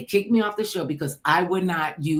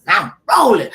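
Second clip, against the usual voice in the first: loud, high studio call; crew call; 4 semitones higher, 9 dB louder than usual. Retold or imitated. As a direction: call it out across the set. Crew call during promo taping.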